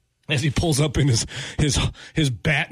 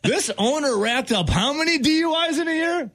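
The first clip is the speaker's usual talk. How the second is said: much higher, mock disbelief; mock shock; 13 semitones higher than usual. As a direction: play the shocked stat reader. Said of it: "mock shocked reader".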